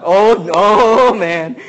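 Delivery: long drawn-out, loud "oh man"; mock amazement. Preacher teasing the audience over their answer, mock amazement. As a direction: stretch it out in playful disbelief.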